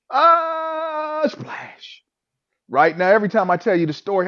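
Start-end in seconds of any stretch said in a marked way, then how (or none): 0.1-1.2 long, high held "aah"; drawn-out comic cry of falling; mock falling scream. Acts out falling from cliff. 1.3-2.0 soft, voiceless splash sound; imitated splash. Imitates hitting the water.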